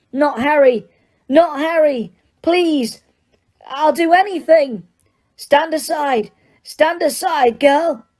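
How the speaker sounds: high, begging cry; pleading mother. Acted. Mother begging the attacker to spare Harry, frantic. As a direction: plead for your child's life.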